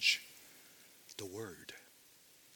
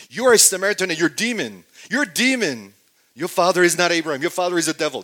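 higher, louder, fast accusing shouts; harsh insults; 6 semitones higher, 10 dB louder, faster than usual. Retold or imitated. Religious leaders hurling harsh insults at Jesus, fast and loud. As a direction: spit the insults out, going at him. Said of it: "saying the most harsh things".

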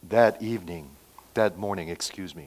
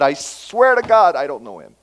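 vehement oath; 3 semitones higher, 8 dB louder than usual. Retold or imitated. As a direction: swear it hard, acting out a desperate denial. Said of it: "Not just casual, "I'm not," but".

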